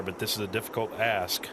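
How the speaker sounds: usual speaking voice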